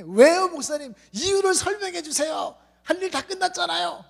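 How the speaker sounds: high, insistent whiny youth; demanding young man. Retold or imitated. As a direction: plead loudly for a reason.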